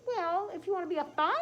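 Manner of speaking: very high, loud and giggly; lamb character voice. Acted. Bouncy lamb laughing at red Tom, high giggly loud voice. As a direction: squeal it like a bouncy little lamb.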